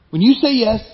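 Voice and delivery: ordinary talk, nothing marked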